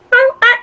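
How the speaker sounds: high, squeaky meowing imitating the squirrel; squirrel meow imitation. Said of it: "he made, like, a noise".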